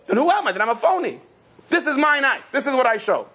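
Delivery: high, impassioned outburst; indignant self-reproach. Acted. Shaykhid catching himself showing off, indignant. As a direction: protest it with rising indignation.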